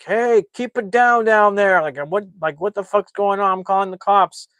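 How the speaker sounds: high, loud complaining call; imitated neighbor yelling. Recapping a neighbor threatening to call the cops, mock yelling. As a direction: holler down as an annoyed neighbor.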